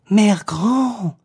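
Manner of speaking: put-on high, slow, puzzled child; wondering little girl. Girl wonders at grandmother's legs, high and slow. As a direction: marvel at it like a small girl.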